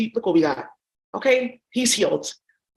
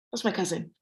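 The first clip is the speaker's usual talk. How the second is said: ordinary talk, nothing marked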